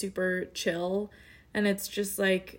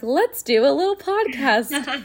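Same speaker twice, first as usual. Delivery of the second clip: playful quote voice; 5 semitones higher, 7 dB louder, slower than usual. Retold or imitated. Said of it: "was like".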